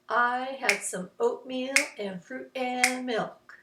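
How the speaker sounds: slow rhythmic chant of the sentence; words in rhythm. Shown as a demonstration. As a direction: stretch 'and' and 'milk'. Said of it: "See how that goes together?".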